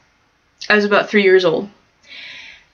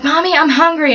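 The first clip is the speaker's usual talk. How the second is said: put-on higher, mildly whiny child; fussing kid; 6 semitones higher than usual. Kid to mom in the car, lightly fussing. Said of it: "started fussing in the car 'cause I'm a kid".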